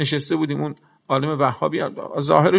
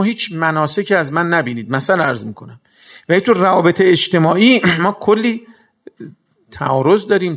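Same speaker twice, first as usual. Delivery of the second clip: preaching at full force; 2 semitones higher, 6 dB louder than usual. Acted. Preacher challenging the audience, louder fervent questioning. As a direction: hammer the point home to the crowd.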